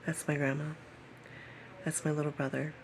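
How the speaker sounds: usual speaking voice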